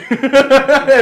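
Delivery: big high laugh bursting out; loud burst of laughter. Real, not acted. Friend laughing hard at his own shirtless story, loud laugh. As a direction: crack up loudly at the memory.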